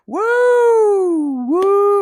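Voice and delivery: two high, loud woos; hype-man whooping; loud whooping cheer. Real, not acted. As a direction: whoop loudly, high and long. Hyping up the stream with whoops.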